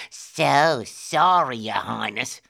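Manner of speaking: slow, sarcastic fake apology; mocking. A narrator's reading. Guard sneers at the boy claiming royalty, mocking. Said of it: "The guard mocked him".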